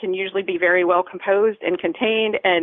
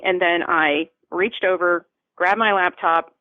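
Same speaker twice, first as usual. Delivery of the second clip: ordinary talk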